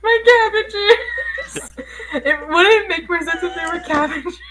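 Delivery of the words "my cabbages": laughing wail. Pretend. Cabbage merchant quote.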